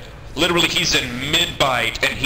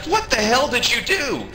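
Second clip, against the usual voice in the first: shocked; 10 semitones higher than usual. Retold or imitated. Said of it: "And he's like".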